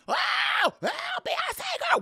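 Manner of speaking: high squealing fan scream, mocking. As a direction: imitate a fan squealing her name. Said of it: "people like to scream".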